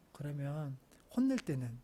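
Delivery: calm, hushed parent; quiet fatherly talk. Acted. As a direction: prompt the child softly. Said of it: "제가 조용히 불러서".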